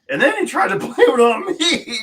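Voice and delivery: high exasperated laugh. Real, not acted. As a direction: laugh in disbelief. Laughing at the mechanic's excuse.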